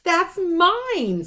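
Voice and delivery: loud, high cry of protest; Harry protesting loudly as his letter is taken; indignant protest. A narrator's reading. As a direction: grab it back, outraged.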